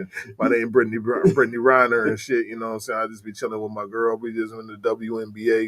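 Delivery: mock deep, low monotone voice; low-pitched dude-voice parody; Brittany Griner impression. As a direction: drop low and laid-back, imitating a deep-voiced athlete. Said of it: "that motherfucker's voice is just".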